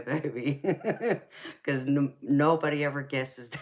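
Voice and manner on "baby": a real laugh breaking through the words. Real, not acted. Streamer laughing at a viewer's guess, laughing. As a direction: giggle through it, then let the laugh out.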